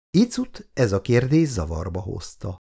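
ordinary delivery, nothing marked

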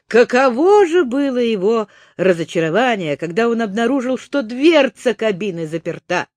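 ordinary delivery

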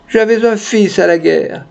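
high, plaintive lament; high-pitched acted grief, no real sobbing; tearful old lady. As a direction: mourn a son as a weeping old woman. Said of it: "dit une vieille dame qui pleurait".

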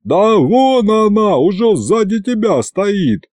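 high, drawn-out reply; crowd calling back. A narrator's reading. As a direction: holler back from across the square. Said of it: "откликнулась площадь".

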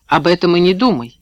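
ordinary delivery

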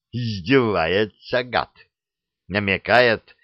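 usual speaking voice

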